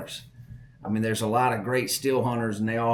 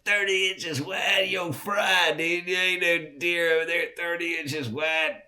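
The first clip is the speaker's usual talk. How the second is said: laughing disbelief; 6 semitones higher than usual. Retold or imitated. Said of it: "I'm going".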